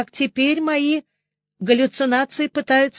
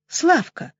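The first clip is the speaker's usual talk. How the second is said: higher, bright call of a name; joyful exclaim; 6 semitones higher than usual. A narrator's reading. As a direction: greet him with relief and joy. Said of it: "радостно воскликнула я".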